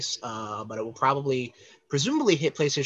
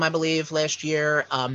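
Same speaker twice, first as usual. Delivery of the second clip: ordinary talk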